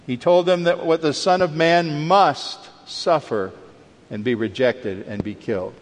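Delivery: ordinary delivery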